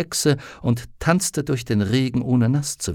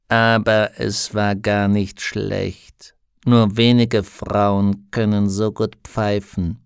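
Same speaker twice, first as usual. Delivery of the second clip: slow raven voice; 3 semitones lower, slower than usual. Acted.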